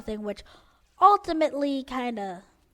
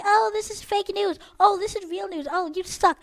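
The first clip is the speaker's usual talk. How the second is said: mock commenters; 7 semitones higher, 4 dB louder than usual. Mocking notification comments.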